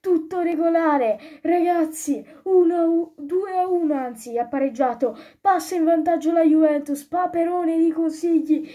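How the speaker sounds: high, loud, thrilled live goal shout; excited goal call. Real, not acted. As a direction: call the goal like a fan commentator. Live goal call, Juve scores. (esultanza da telecronaca)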